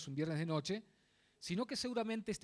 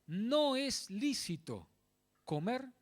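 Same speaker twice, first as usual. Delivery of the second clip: "no es lícito" very slow and raised in pitch; stress on no es lícito; 4 semitones higher, much slower than usual. Real, not acted. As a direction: set "no es lícito" apart, word by word. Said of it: "recalco esto". (recalcar "no es lícito", muy lento y más agudo)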